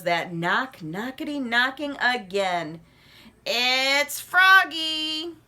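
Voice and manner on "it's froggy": very slow loud croaky call; drawn-out frog call. Acted. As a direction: stretch it out through the door. Froggy at door.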